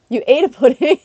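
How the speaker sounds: high, loud laughing question; amused disbelief. Real, not acted.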